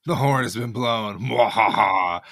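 loud gloating villain with an evil laugh. Pretend. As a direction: crow it like a cartoon villain. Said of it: "She's so evil".

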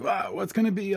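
high, wide-eyed wonder; excited kid. Acted. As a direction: gasp it like an eager child. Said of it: "he's like a kid".

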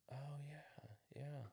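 hushed, half-whispered imitation of dad conceding; quiet concession. Dad looking at the finished fix, quietly conceding. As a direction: murmur it as dad inspecting the repair.

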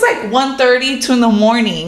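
ordinary talk

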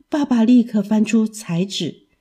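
ordinary delivery

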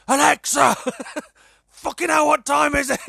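high, loud yell at the speaker; shrieking in frustration at Alexa; exasperated shout. Retold or imitated. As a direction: bellow it at a device that won't listen. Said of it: "I have to shout at her".

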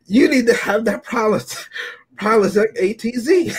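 a light send-up of a commercial spokesman; mock comedian line. Imitating comedian's movie line.

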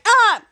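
short, high, loud mock yelp; startled yell. Captain Man turning round, startled yell.

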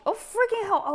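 sudden high, loud exclamation; startled outburst. Real, not acted. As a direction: yelp in alarm as it spills. Liquid spills while pouring.

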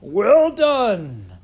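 loud, high jovial shout; big drawn-out hearty cheer; crying out. A narrator's reading. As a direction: applaud the dancers at full voice. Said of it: "cried out".

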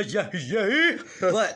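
high, loud, laughing yay; laughing cheer. Real, not acted. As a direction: cheer through a laugh, then slow down. Mock cheer then drag.

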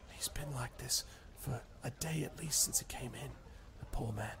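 soft unvoiced whisper. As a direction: hush it to the player. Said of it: "she whispers".

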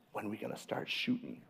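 put-on a whispered little-girl ask; whispered child question. Daughter to dad in hunting blind, whispered. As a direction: breathe it like a kid in the blind.

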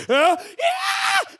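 high loud yeah; psyched shout. Retold or imitated. Storyteller starts sprinting through mud, high excited whoop.

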